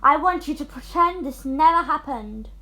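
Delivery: angry demand. Acted. Stepmother demands.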